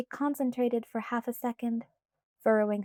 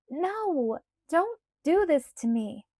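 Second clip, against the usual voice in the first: a touch of pleading, a little louder; mild protest; 6 semitones higher, 4 dB louder, slower than usual. A narrator's reading. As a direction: push back against Alice, half-begging. Bella protesting.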